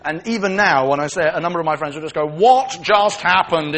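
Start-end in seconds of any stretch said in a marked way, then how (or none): 2.2-3.8 slow, loud mock amazement; mimicked stunned disbelief. Friends teasing him with his own line, mock stunned disbelief. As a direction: imitate the stunned line, stretching every word. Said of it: "in that voice".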